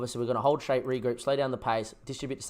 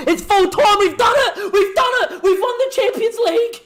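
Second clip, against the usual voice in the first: screamed much higher and louder, pure joy; ecstatic screaming; 11 dB louder than usual. Real, not acted. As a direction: scream the victory at the top of your lungs. Wins Champions League final.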